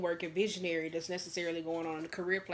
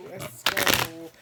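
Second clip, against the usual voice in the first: loud; 8 dB louder than usual. Real, not acted. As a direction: punch it out loud. Repeated hype call, soft then loud.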